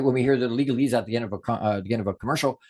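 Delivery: fast legal-disclaimer rattle; talking really fast. Shown as a demonstration. Teacher copies a commercial's rushed fine print. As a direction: rush it like the end of an ad. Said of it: "if I'm talking really fast".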